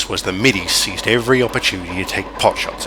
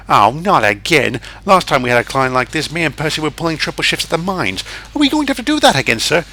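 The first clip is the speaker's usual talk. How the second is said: higher, lively small engine complaining; whiny Percy; 6 semitones higher than usual. Acted. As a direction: moan about extra shifts.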